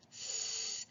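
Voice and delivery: a long unvoiced sss; hissed S sound. Shown as a demonstration. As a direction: hiss through the teeth. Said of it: "look how my teeth are together".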